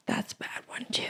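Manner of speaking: whispered through cramp pain. Real, not acted. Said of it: "my foot is cramping".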